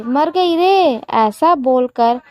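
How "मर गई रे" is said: sudden cry of pain, pitched high; "मर गई रे" cried out in pain; pained yell. Acted. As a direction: yelp out as the leg is yanked. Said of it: "Bharti चिल्लाई".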